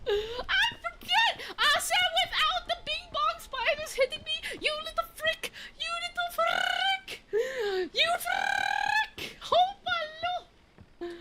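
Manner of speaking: high laughing outrage; laughing squeal. Real, not acted. Spider hits, shrieking laugh.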